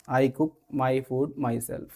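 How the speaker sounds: soft and slow, gentle; lovingly. Shown as a demonstration. Telling someone who listens the first time, gently. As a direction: say it kindly. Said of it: "अगर किसी से प्यार से बात करनी है".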